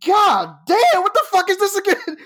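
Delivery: blown away by the taste; high-pitched disbelieving cry; amazed exclamation. Retold or imitated. Amazed at second sip.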